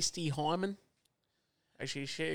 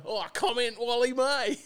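higher, louder mock commentator chime-in; imitating Ian Day; 7 semitones higher, 7 dB louder than usual. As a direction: pipe in like an old radio caller. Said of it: "then Ian Day would pipe in".

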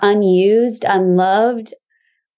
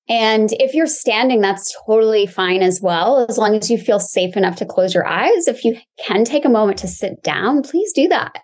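calm grounding exercise. Acted. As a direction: guide them gently into the body.

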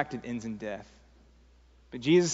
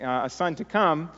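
normal talking voice

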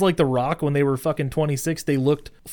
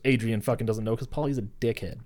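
usual speaking voice

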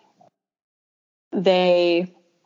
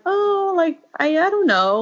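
high, hesitant imitation of the unsure dads; lightly mocking, higher voice of two puzzled guys; 11 semitones higher than usual. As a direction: mimic the fathers' confused dithering, voice pitched up. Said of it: "They were kind of like".

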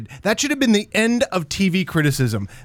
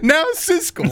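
high-pitched mock argument opener; Ebert; 10 semitones higher, slower than usual. Imitating critics beginning an argument, high mock-combative opener. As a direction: kick off a critics' squabble in imitation. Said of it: "that was a good impersonation".

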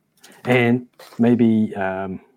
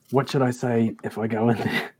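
normal talking voice

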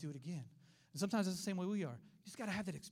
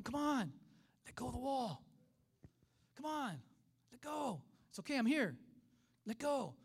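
higher, spaced-out urging; coaxing call; 6 semitones higher, slower than usual. Retold or imitated. Preacher as God calling swimmer off the wall, coaxing. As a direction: coax a scared child to let go.

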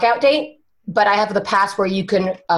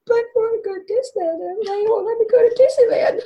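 put-on very high, quick childish wail; hammed-up tearful pleading; mock crying; 13 semitones higher, faster than usual. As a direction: whine it like a heartbroken kid in tears. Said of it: "I think I cried a little bit".

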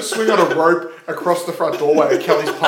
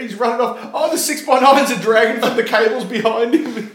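higher, louder play-by-play excitement; mock sports commentary; 7 semitones higher, 6 dB louder than usual. Podcaster calling an imagined car-stereo thief's run, mock excited commentary. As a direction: call it like a frantic obstacle-race commentator.

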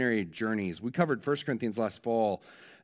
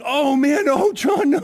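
much higher and louder, a mock alarmed cry; retold panic; 12 semitones higher, 9 dB louder than usual. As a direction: relive the moment of panic at the injury. Mother retells her panic.

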